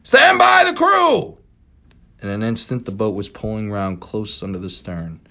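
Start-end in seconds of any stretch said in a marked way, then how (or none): 0.0-1.4 commanding shout. Acted. Ahab orders crew.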